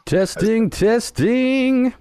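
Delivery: high, booming announcer testing; game show announcer. Pretend. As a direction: ham up a game show host. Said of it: "you sound like a game show announcer".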